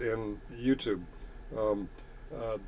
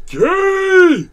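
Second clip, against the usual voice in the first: loud, very high drawn-out shriek; hammed-up heckler's yell, pitch shooting up; mock yelling; 13 dB louder than usual. Streamer imitating everyone in chat yelling out, mock shriek. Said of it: "Everyone yelling out".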